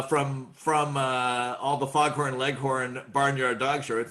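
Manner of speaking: ordinary talk, nothing marked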